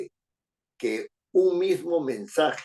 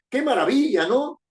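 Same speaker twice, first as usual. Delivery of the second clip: much higher, louder exclaimed wonder; exclamation; 12 semitones higher, 5 dB louder than usual. Shown as a demonstration. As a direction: burst out in delight. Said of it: "empiezo con admiración, termino con interrogación".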